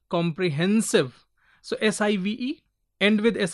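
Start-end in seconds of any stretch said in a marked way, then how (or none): 0.1-1.3 "Comprehensive" with hen emphasized; stress on hen. Shown as a demonstration. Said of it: "hen is given little more".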